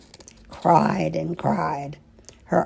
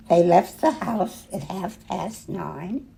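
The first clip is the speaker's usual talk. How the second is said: ordinary delivery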